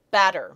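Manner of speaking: "Batter" hit on BAT, then dropping away; trochaic stress. Shown as a demonstration. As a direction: punch the first syllable of "Batter". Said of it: "stress the first syllable".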